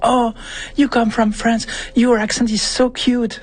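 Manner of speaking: mock gushing anglophone. Mocking how anglophones compliment his French accent, cooing. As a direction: coo it like a charmed English speaker. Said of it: "ils entendent".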